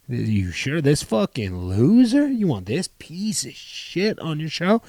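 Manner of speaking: slow, drawn-out mock disbelief, swooping up; imitated scornful questioning; incredulous put-down. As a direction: imitate a club boss doubting the pick, stretching each insult. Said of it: "You know, typical Dino".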